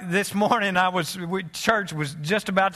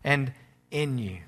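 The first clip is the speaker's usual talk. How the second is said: reflective sermon; 3 semitones lower than usual. Acted. Quiet reflective sermon close.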